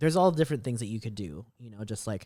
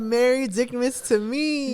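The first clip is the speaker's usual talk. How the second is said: playful quote; 12 semitones higher, 7 dB louder, slower than usual. Retold or imitated. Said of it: "She said".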